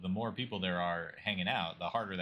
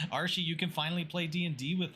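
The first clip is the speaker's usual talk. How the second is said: high, loud excited squeal; thrilled squeal; 9 semitones higher, 3 dB louder than usual. Real, not acted. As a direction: squeal with delight, laughing. Thrilled at the raid.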